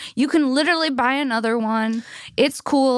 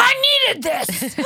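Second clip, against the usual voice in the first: much higher, slow, thrilled cry; excited outburst; 12 semitones higher, slower than usual. Retold or imitated. Potter thrilled at drawing the owl card, high excited cry. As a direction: squeal it like a dream came true. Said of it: "He's like".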